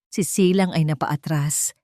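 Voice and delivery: ordinary delivery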